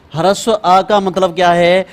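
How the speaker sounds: ordinary talk, nothing marked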